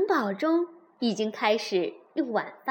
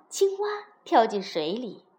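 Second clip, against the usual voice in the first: usual speaking voice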